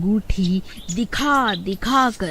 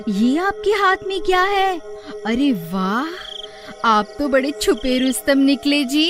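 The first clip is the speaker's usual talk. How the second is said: high-pitched wifely amazement; delighted surprise; 7 semitones higher than usual. Acted. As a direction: gush over an unexpected gift. Wife discovers the ring. (खुशी भरी हैरानी से)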